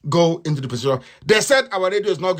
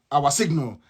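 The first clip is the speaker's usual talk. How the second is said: normal talking voice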